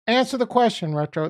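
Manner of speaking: loud, high demand to answer; calling out loud. Real, not acted. Streamer calls out to silent viewer, loud and high. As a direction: call out to him loud and high.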